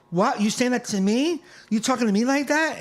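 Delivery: high, indignant challenge; imitated offended woman. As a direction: voice a drunk woman taking offense. Offended drunk woman confronts comic.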